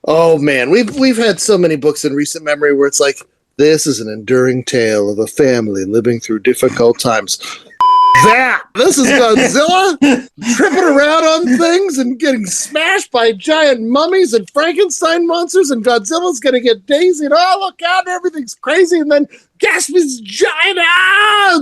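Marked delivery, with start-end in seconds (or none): 3.6-7.4 mock trailer voice. Mocking self-serious prestige books. 8.2-21.6 higher and higher, rushing with glee; excited rave. Real, not acted. Fan raving to cohosts about the comic, building excitement.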